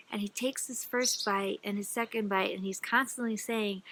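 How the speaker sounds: ordinary delivery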